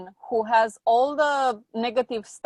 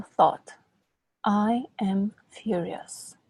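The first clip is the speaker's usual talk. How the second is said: guided exercise; 2 semitones lower, slower than usual. Acted. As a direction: lead the client through it slowly. Guided anger-release exercise.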